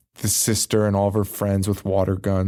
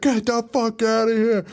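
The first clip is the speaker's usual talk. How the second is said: imitated yell; 10 semitones higher than usual. Boyfriend yells at intruders.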